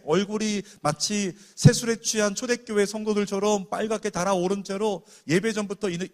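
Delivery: loud, ringing preacher's build; fervent preaching. Acted. Preacher describing the revived sister, loud and fervent. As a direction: proclaim it with fire.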